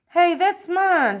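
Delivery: high, loud, drawn-out protest; indignant shout. A narrator's reading. Bug shouts from the floor at the apple thief, loud protest. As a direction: shout it out slowly, claiming what's yours. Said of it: "shouts Schubert from the floor".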